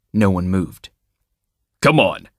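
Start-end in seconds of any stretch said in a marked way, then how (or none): 1.8-2.3 a touch of impatient command; Tom, mildly angry with the group; mild anger. A narrator's reading. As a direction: curtly order them out. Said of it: "he said angrily".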